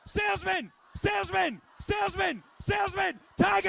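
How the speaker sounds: high, loud call-outs; shouted calls. Real, not acted. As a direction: bark each word out to pump the crowd. Hype game shouting animal words.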